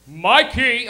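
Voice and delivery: loud, stern father's call; imitated dad. Dad at son's door, calling loudly. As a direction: call the name out hard, as a strict father. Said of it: "If my dad caught me smoking weed".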